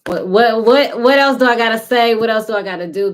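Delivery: high, loud, fed-up rant; hammed-up rant at kids who won't listen; mock exasperated parent. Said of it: "You like".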